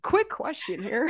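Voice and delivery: laughing through the words. Real, not acted. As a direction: giggle it out, high. Laughing at own phrase.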